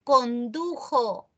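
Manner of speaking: slow, drawn-out "condujo" with the jota clear; stressed answer. Shown as a demonstration. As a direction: linger on "condujo" to show the form. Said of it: "lo acabamos de decir".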